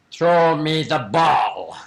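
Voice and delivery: put-on slow, loud, high Hungarian-accented robot call; a loud, drawn-out Hungarian-accented loudspeaker voice. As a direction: boom it out like a synthesized voice with a Hungarian accent. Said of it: "with the Hungarian accent".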